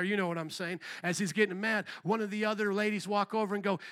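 usual speaking voice

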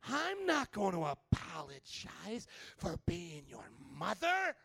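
slow, indignant mock refusal; hammed-up offended mom voice, every word drawn out; mad mother. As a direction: refuse in slow, affronted outrage. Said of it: "I'm using my voice".

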